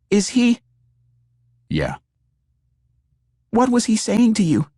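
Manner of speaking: high, anxious woman's voice. A narrator's reading. Woman's voice asking after death.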